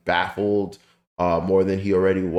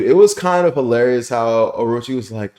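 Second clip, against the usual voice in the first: normal talking voice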